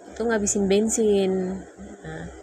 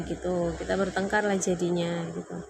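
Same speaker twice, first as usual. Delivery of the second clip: ordinary talk, nothing marked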